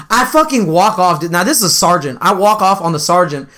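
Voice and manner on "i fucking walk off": ordinary talk, nothing marked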